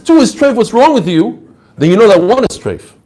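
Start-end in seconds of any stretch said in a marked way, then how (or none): 0.0-1.4 higher, exasperated scolding; a lighter take of the outraged teacher; mock indignant rebbe. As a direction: play the rebbe fed up with the question. Rabbi scolds a foolish question.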